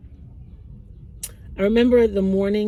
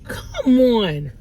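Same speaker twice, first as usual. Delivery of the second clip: crying; 7 semitones higher, much slower than usual. Real, not acted. Mother breaking down recalling leaving daughter, crying. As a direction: scold yourself through real tears.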